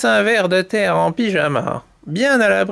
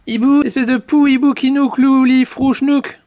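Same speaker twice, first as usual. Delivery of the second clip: higher, rattled-off indignant insult; indignant scolding; 5 semitones higher, 4 dB louder than usual. Acted. Ostrich scolds the owl who dropped his glasses, indignant. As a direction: fling a silly string of insults.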